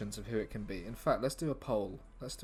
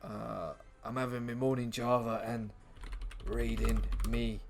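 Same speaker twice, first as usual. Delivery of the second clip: British coder voice; slower than usual. Acted.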